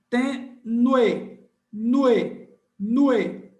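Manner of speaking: tenue then nue repeated loudly; loud classroom drilling of tenue; syllable demo. Shown as a demonstration. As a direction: hammer out nue as one sound. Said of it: "um movimento só também".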